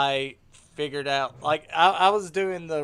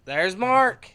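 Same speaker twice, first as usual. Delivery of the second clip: excited greeting; 7 semitones higher, 5 dB louder than usual. Real, not acted. Mark joins the call.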